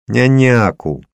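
put-on high, drawn-out toddler demand; small child. A narrator's reading. Little daughter begs her father, childish.

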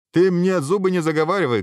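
high, loud indignant shout; angry shout. A narrator's reading. Hero cutting off the chatty dragon, angry shout. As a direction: shout down the small talk. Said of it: "крикнул герой".

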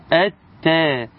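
"التاء" as at-taa', no L, doubled ت; assimilated lam. Shown as a demonstration. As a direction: go from hamza straight into a doubled ت in "التاء". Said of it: "إلى التاء وشددها".

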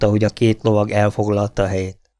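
usual speaking voice

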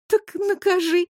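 high teasing dare; playful dare. A narrator's reading. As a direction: dare him, grinning. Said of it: "в шальной улыбке".

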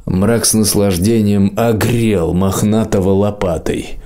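ordinary delivery, nothing marked

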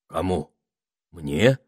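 short baffled questions; mildly bewildered echo; puzzled. A narrator's reading. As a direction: ask haltingly, not quite following. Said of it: "озадаченно переспросил Этельред".

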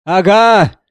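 high, loud, hungry exclamation; greedy gasping cry. Acted. Composer clutching his sheet music, greedy loud cry. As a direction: seize the find with a frightening gasp. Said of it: "жадно и страшно дышал он".

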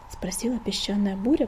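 plain reading voice

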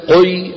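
قي with the qaf pushed thick and heavy; overdone heavy qi. Shown as a demonstration. Teacher showing students an exaggerated qaf. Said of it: "بالغ في القاف المكسورة".

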